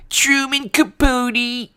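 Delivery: very high, slow, drawn-out affected voice; hammed-up high impression, half speed; Truman Capote. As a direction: stretch it out in a high mannered whine. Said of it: "who's the actor a long time ago who talked like this".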